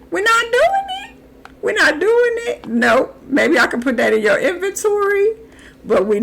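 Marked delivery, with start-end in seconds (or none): 0.1-1.2 squeaky high-pitched "We're not doing it."; sing-song high refusal; high mock refusal. 1.6-2.7 high mock refusal. High-pitched repeat.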